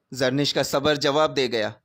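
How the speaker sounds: usual speaking voice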